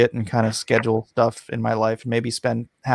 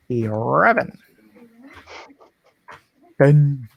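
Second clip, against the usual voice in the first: goofy countdown. Pretend. Silly auction countdown, varied funny voices.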